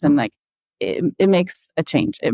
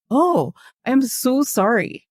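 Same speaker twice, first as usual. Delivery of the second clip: cook's apology; 8 semitones higher, slower than usual. Retold or imitated. Cook apologizes.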